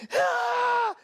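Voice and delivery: high-pitched imitated scream; child's happy scream. Happy children shouting as they run, high scream. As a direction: scream like a kid tearing around. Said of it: "están gritando y corriendo".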